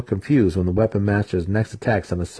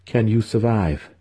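usual speaking voice

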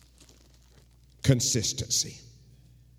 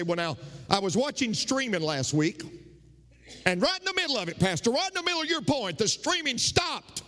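whiny complaint; 11 semitones higher than usual. Retold or imitated. Congregant griping to the pastor about the stream, high whiny voice. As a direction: pitch it way up like a fussy congregant griping.

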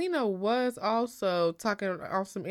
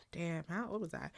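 unvoiced whisper to herself; whispered aside; 3 semitones lower, 13 dB quieter than usual. Real, not acted. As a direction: whisper the question to yourself. Aside to self.

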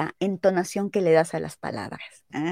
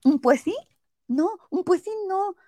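higher, wavering between yes and no; hesitant mm; 8 semitones higher than usual. Retold or imitated. Friend unsure whether to say the outfit works, hedging. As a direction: hum and hedge uneasily. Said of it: "y así como de".

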